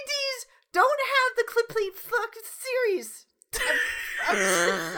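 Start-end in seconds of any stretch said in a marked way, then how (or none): 3.6-5.0 words choked by real laughter; incoherent with laughter. Real, not acted. Host too worked up to get words out, incoherent laughing. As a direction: try to speak through helpless laughing. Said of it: "were those words?".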